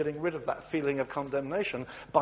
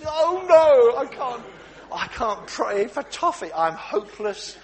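high, loud wail of despair; hammed-up self-pity; mock despair, loud and high; 7 semitones higher, 10 dB louder, slower than usual.

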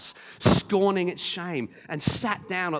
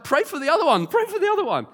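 high, loud, excited plea; mock amazed urging; 13 semitones higher, 7 dB louder, faster than usual. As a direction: imitate an astonished teenager begging urgently. Amazed teenager urging, acted.

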